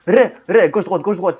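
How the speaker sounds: excited yelling. Real, not acted. Frantic mid-fight gaming.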